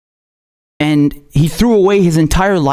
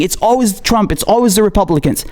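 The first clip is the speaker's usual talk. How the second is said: ordinary delivery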